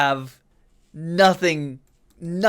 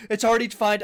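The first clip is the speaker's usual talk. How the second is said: ordinary talk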